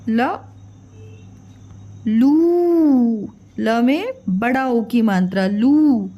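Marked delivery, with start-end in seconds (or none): none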